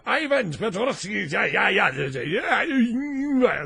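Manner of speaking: hammed-up, fumbling Russian accent; attempted Russian accent. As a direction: try out a mock Russian accent, searching for it. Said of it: "how do you do the Russian accent?".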